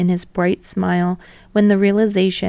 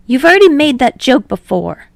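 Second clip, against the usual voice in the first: high, louder, playful protest; 6 semitones higher, 8 dB louder than usual. Acted. Hinata shoving Tsukishima over the joke, high and playful. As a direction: push back playfully.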